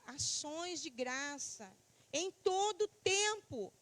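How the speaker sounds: loud, slow proclamation of scripture; declaimed Bible verse, drawn-out words; scripture reading. Acted. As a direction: read the verse out slowly, projected to the congregation.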